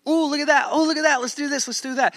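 put-on high-pitched, eager little-kid voice; excited young kids. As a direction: play kids begging to see and do everything. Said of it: "for us three young kids".

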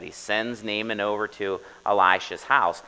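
ordinary delivery

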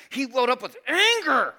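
dramatic welling-up of anger; acted-out anger. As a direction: voice swelling high and loud. Preacher acts out welling anger.

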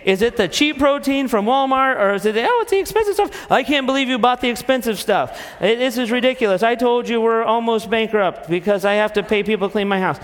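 hammed-up husband losing it; theatrical indignant rant; mock outraged husband. Acted quarrel, husband ranting.

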